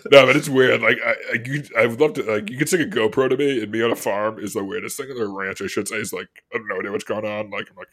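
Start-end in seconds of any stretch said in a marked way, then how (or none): none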